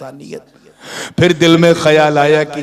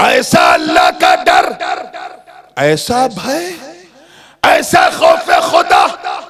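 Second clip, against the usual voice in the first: full-force preaching; 6 dB louder than usual. A narrator's reading. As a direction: exclaim in awe, phrase by phrase. (पूरे जोश का गरजता बयान)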